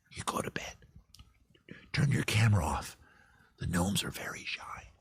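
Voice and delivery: hushed, breathy whisper so the gnomes won't hear; conspiratorial whisper about shy gnomes. Pretend. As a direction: whisper it like a secret. Secret gnome-watching instructions.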